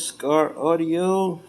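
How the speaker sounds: ordinary talk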